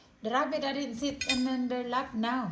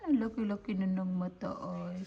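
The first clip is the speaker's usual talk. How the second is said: ordinary delivery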